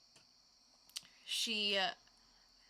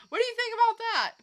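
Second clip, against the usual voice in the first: excited question; 10 dB louder, much faster than usual. Real, not acted. Asks co-host eagerly.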